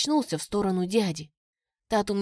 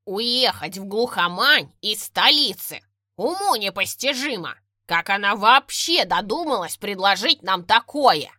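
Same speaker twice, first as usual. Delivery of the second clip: muffled yell; 4 dB louder than usual. A narrator's reading. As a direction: yell from the far end of the line. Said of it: "приглушённый вопль мистера Уоррена".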